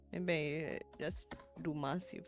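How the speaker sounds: normal talking voice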